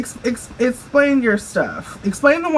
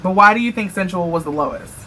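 ordinary talk

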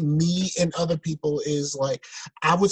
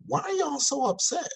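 baffled question; 11 semitones higher, 5 dB quieter than usual. Retold or imitated. Retells his reaction to angry reviews.

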